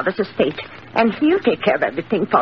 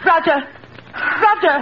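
calling out; 8 semitones higher than usual. Acted. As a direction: shout for him across the house. Calling nephew from another room.

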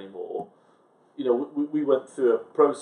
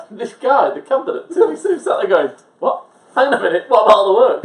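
incredulous retelling; 10 semitones higher, 10 dB louder than usual. Retold or imitated. Retold exasperated client protest.

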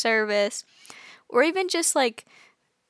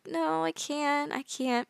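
slow, whiny no; whiny refusal; 3 semitones higher, 5 dB quieter, slower than usual. Retold or imitated. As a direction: drag out the refusal like a sulky kid. Refusing to order herself.

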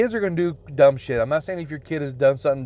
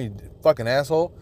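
ordinary talk, nothing marked

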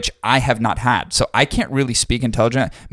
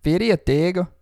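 higher Spanish accent on Fury Tego, flapped r; 7 semitones higher than usual. Shown as a demonstration. Asked to try Spanish, he says the brand name in a Spanish accent. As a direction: say it like a Spanish speaker. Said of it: "try Spanish".

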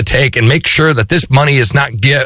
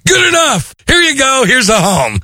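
breezy banker; 11 semitones higher than usual. Retold or imitated. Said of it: "And he'd be like".

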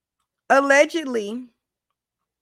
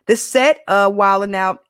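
ordinary talk, nothing marked

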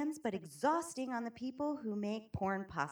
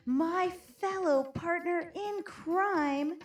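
building, louder and higher hype; hyped introduction; 5 semitones higher, 4 dB louder than usual. Acted. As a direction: hype up the guest.

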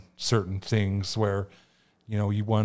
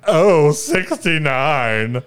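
very slow, drawn-out, louder call; bingo caller; 4 semitones higher, 8 dB louder, much slower than usual. Retold or imitated. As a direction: stretch each word like a bingo caller. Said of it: "But she would go".

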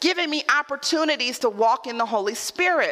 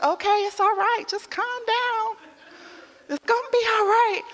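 husband calming her; 8 semitones higher than usual. Retold or imitated. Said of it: "he's like".

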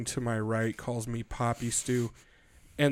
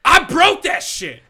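loud, high, slow yell; triumphant shout; 12 dB louder, slower than usual. Real, not acted. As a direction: crow it out proudly.

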